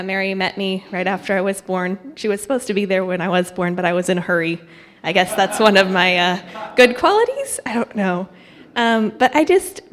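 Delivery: ordinary talk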